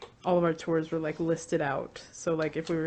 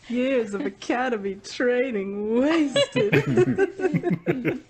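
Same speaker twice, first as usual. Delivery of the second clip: laughing; 6 semitones higher, 6 dB louder, much slower than usual. Real, not acted. Mock lament over Gigi.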